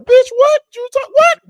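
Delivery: very high, loud squawk of disbelief; hammed-up outraged squeal; retold disbelief. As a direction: blurt it out in exaggerated high-pitched shock. Retold shock at cancer diagnosis.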